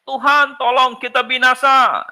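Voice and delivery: panicked cry for help. Retold or imitated. Disciples crying to Jesus in the storm, panicked plea. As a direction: cry out to the Lord as the boat sinks.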